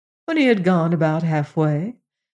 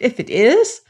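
higher, eager resolve; raised, eager voice; exclaiming; 5 semitones higher, 4 dB louder than usual. A narrator's reading. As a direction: declare it with excited determination. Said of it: "he exclaimed".

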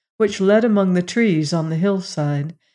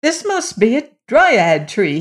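higher, excited realization; raised, astonished voice; exclaiming; 5 semitones higher, 4 dB louder than usual. A narrator's reading. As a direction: exclaim it in sudden discovery. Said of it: "he exclaimed".

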